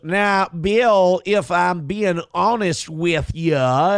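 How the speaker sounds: slow, drawn-out Southern drawl impression; hammed-up Southern accent; John Kennedy. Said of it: "And with that accent".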